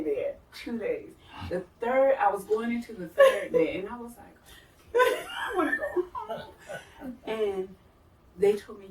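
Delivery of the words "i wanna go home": high, slow mock-crying whine; homesick wail. Stuck on the ward third day, mock-tearful wail. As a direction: wail it high, half laughing.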